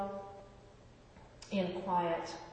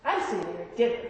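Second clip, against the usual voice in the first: normal talking voice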